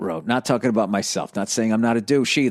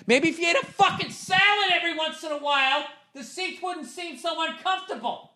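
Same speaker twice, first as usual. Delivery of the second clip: mocking squeaky put-down; 4 dB quieter than usual. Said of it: "I so wanted to be like".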